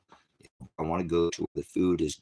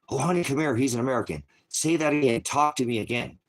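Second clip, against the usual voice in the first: high, louder gushing foreign lady; foreign woman gushing; 6 semitones higher, 4 dB louder than usual. Retold or imitated. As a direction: coo over the American like an excited stranger. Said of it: "I say, Kevin".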